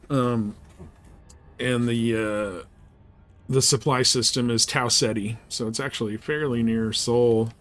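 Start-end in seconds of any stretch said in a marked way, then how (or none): none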